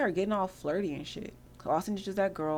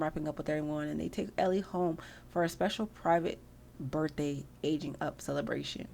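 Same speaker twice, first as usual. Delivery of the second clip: normal talking voice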